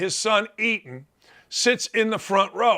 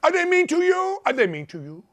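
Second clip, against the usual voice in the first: high, cooing mock sympathy; teasing parent baby talk; 9 semitones higher than usual. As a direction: coo it like babying a child. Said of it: "That's what I used to tell my son".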